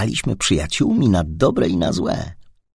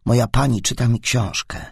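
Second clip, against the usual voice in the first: ordinary delivery, nothing marked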